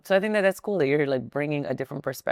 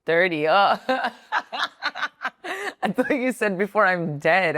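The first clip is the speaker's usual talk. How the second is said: bursting into laughter mid-sentence; laughing; 5 semitones higher, 3 dB louder than usual. Real, not acted. Laughing at her own mishearing, high and giggly. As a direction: laugh through the words.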